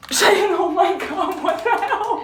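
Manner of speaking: high, drawn-out laughing shock; giddy disbelief. Real, not acted. Woman to her friend about the pregnancy test, giddy disbelief. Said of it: "in complete disbelief".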